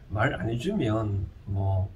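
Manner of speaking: ordinary talk, nothing marked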